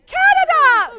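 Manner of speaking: high, loud triumphant answer; host calling the answer in triumph; shout. Real, not acted. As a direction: belt out the answer.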